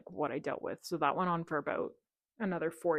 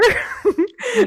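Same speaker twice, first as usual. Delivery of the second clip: high burst of laughter; 12 dB louder than usual. Real, not acted. Laughing at Giant Tiger.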